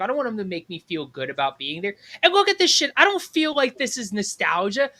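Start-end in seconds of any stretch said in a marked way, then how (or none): 2.2-4.9 high-pitched indignant outburst; fed-up, high-pitched complaint; worked-up rant. Real, not acted. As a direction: rant in exasperation, voice raised in pitch. Ranting that it is no diner.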